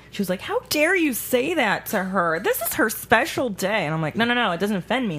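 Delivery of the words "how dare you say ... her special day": higher, slow indignant scolding; mad saleswoman. Retold or imitated. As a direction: imitate an outraged saleswoman taking offence. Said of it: "the saleswoman got so mad".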